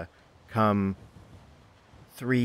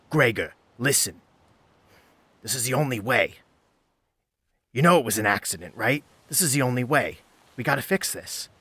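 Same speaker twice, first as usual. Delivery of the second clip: lightly acted urgent insistence, pitched higher; bickering rangy youth; 5 semitones higher, 3 dB louder than usual. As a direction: press Gregor, a touch of bickering. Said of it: "They begin to bicker amongst themselves".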